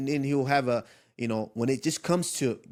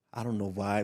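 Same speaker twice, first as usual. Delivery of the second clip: ordinary delivery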